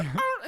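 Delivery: high-pitched kicked-dog cry; imitated dog yelp. Imitating the dog heard off screen, high yelp. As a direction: yelp sharply like a startled dog.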